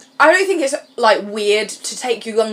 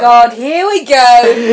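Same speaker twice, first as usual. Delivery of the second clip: exasperated; 2 semitones higher, 8 dB louder, much slower than usual. Real, not acted. Exasperated groan at twist.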